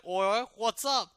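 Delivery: slow, exaggerated Chav accent. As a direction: drag it out in a terrible Chav accent. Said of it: "terrible Chav accent".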